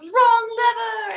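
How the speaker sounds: high, loud, drawn-out wail; hammed-up exasperated cry; cartoon villainess impression. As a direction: holler it slowly as a foiled villainess. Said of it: "And she goes".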